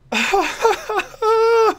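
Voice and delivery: a high squealing laugh of disbelief; disbelieving laugh. Real, not acted. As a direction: laugh high, almost crying with joy. Can't believe the result.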